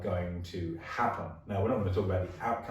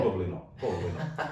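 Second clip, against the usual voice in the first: laughing. Real, not acted. Wry answer about banks.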